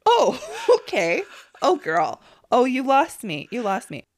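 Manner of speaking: big loud reaction, easing off at the end; retold reaction. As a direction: act out "oh girl, you've lost me". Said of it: "I was like".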